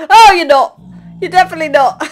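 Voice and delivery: high, loud yelp; shouted realisation. Real, not acted. A pigman she greeted attacks her, loud shriek.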